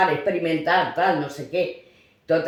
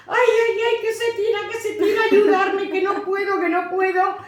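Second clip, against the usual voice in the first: much higher, frightened wailing; panicked cry for help; 12 semitones higher than usual. Retold or imitated. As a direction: cry out that the boy is falling. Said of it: "la oigo que empieza". (otra madre pidiendo ayuda, chillido agudo de pánico)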